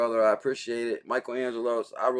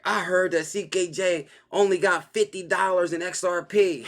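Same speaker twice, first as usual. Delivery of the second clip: lightly voiced mocking tease, a bit higher; mocking quote; 6 semitones higher than usual. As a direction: tease the line like the other guy said it. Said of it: "He was like".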